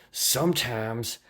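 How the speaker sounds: put-on high, drawn-out Southern accent. Reading a viewer's comment aloud, put-on Southern accent. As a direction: read it slowly in a put-on Southern drawl. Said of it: "doing this fucking Southern accent".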